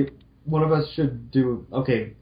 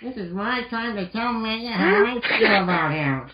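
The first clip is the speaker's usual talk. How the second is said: put-on higher, bright girl-robot voice; cheerful Roll voice; 11 semitones higher than usual. Roll greeting Mal cheerfully in a higher voice. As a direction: play sweet Roll greeting her friend. Roll's inner thought.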